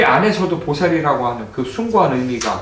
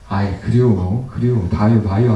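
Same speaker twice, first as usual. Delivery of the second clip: Chungcheong brush-off; 8 semitones lower than usual. Retold or imitated. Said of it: "우리는 오래 가지".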